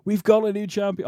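a hint of an announcer calling the title win; J R call impression. Podcast host recreating a famous title call, lightly imitated. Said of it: "I cannot do it justice".

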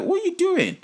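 high, incredulous squeak. Real, not acted. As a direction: jump way up in disbelief. Exasperated rhetorical question.

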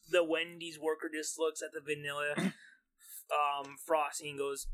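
normal talking voice

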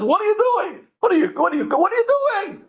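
high-pitched, agitated demand, repeated; mock yell, high and insistent; yelling nurse. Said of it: "She starts yelling at my grandmother".

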